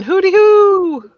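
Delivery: high, slow, loud hooting call; bigfoot whoop. Retold or imitated. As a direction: hoot it out like a bad Bigfoot call.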